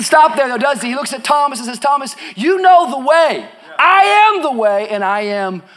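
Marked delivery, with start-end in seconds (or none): 1.8-5.6 high, loud preacher's proclamation; forceful declaration. Acted. Jesus answering Thomas, proclaimed forcefully. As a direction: declare it with ringing authority.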